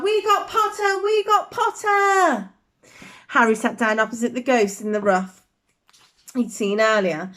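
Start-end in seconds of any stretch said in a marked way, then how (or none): 0.0-2.5 high chanting yell, slowing on the repeat; twins yelling. Acted. Twins cheering for their new housemate, high happy yell. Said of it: "the Weasley twins yelled".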